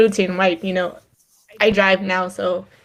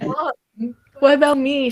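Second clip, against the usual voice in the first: raised, hammed-up whine of a left-out kid; mock jealous; 7 semitones higher than usual. Scene: son to his mom who greets only the dog, mock hurt. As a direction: play the ignored child, higher.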